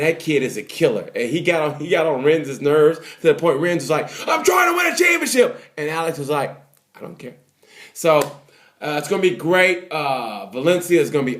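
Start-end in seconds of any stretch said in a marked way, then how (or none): 4.3-5.6 high-pitched imitation of a frantic yell; mock desperate shout. Rins protesting as Marquez gets on his nerves, mock shout. 7.0-7.4 very soft, offhand murmur; mock indifference. Alex brushing off a frantic Rins, hushed mock indifference.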